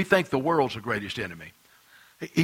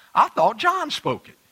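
mock confused listener; 11 semitones higher, 3 dB louder than usual. Voicing a confused congregant.

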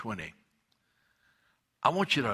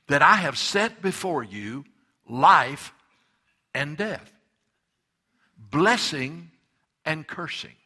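preaching; 3 semitones higher, 6 dB louder, slower than usual. Acted. Preaching scripture.

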